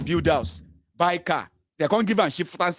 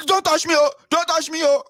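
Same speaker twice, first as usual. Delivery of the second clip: mock protest; 11 semitones higher, 5 dB louder than usual. Mock protest at being grabbed.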